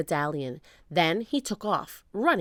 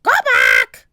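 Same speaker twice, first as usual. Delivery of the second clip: loud, harsh bird squawk; loud parrot squawk; 3 semitones lower, 14 dB louder than usual. A narrator's reading. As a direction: screech it like a parrot. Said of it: "squawked Polly".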